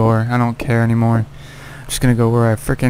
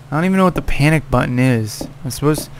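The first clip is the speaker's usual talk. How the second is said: normal talking voice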